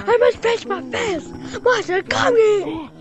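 ordinary talk, nothing marked

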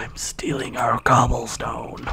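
slow, soft whisper; sneaky whisper. Pretend. Player stealing shared cobblestone, sneaky slow whisper. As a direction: whisper it slowly like a sneaky thief.

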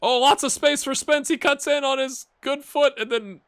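very high, loud commentator call; live play-by-play. Acted. Streamer calling Spence cutting in, excited football commentary. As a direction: call the attack with rising excitement.

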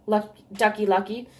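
ordinary delivery

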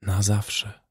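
soft, faraway echo; dreamy. A narrator's reading. Jim repeats the words without looking at him, dreamy. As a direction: repeat it softly as if lost in a dream. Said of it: "powtórzył marzącym tonem".

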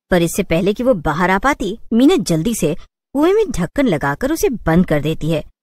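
usual speaking voice

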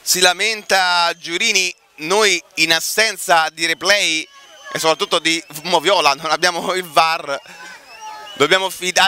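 usual speaking voice

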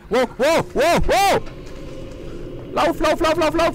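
high, loud panicked yelling; panicked shouting. Real, not acted. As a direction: scream at yourself to run. Monster chase in horror game.

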